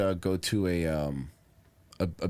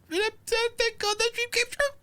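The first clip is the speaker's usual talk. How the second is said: mock high voice; slower than usual. Sarcastic mock celebration.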